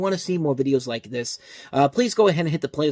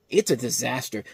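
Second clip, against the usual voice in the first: ordinary talk, nothing marked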